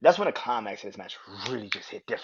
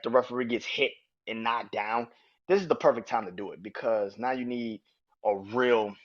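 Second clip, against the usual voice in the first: ordinary talk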